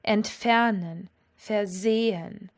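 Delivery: Entfernen, versehen, slowly, stressed ent-FER-nen, ver-SE-hen; stress on second syllable. Shown as a demonstration. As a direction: leave the prefixes unstressed in Entfernen and versehen, drawn out. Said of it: "all work in the same way".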